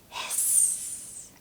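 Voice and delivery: soft, voiceless hiss; whispered snake hiss. Shown as a demonstration. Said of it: "The sneaky snake".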